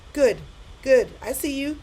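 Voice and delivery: ordinary delivery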